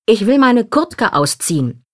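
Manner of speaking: understated cry for the whole carriage to hear; mild narrated shout. A narrator's reading. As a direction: read a child's loud demand with restraint. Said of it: "schreit sie laut und deutlich".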